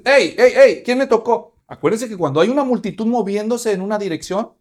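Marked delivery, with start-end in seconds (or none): 0.0-1.5 loud, high call over a crowd. Acted. Jesús halts the pushing crowd with a loud call. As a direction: stop everyone with a shout. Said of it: "en medio de la multitud".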